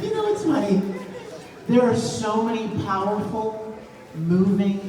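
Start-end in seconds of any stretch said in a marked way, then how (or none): 0.0-0.8 high squeaky repeat. Pretend. Repeat in a different voice.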